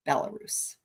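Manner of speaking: "Belarus" breathed out in a whisper, no voice; whispered name. Shown as a demonstration.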